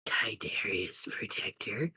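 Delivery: low, soft whisper; hushed villain's jab; whispered taunt. Acted. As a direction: whisper it mockingly. Villainess taunts fallen hero.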